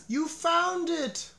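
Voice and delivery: high, slow, delighted exclamation; a high delighted cry of discovery; excited cry. A narrator's reading. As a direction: Toad cries out in joyful discovery. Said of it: "cried Toad".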